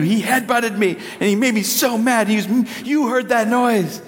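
put-on a kid pleading her case; lightly voiced upset child; imitating indignant daughter. Daughter complains about brother.

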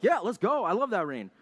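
lightly hammed-up excitement; mock enthusiasm. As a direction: play an eager fan. Voices people who love rain.

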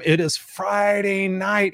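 drawn-out show-host announcement; announcer intro. Real, not acted. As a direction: stretch out FRIDAY like a ring announcer. Show opening, stretched announcer welcome.

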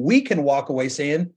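ordinary delivery

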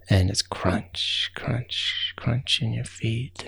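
soft, slow, breathy crunch crunch crunch; hushed crunch. A narrator's reading. Storyteller evoking snow underfoot, hushed and slow. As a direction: let each crunch land softly and slowly.

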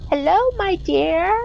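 put-on high, thin old woman's greeting; frail old woman. Old stranger greeting the woman raking, frail crone voice. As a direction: croon it like an ancient witch. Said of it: "very elderly woman's very frail voice".

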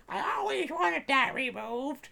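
high, slow imitation of a lady; mimicking the lady. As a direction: put on a high, drawn-out lady voice. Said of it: "she was always like".

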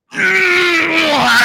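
high, strained straining grunt; mock toddler grunt. Dad imitating his toddler straining in the bathroom. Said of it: "they look at you and just go".

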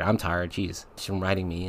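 ordinary talk, nothing marked

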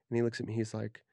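normal talking voice

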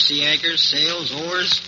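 normal talking voice

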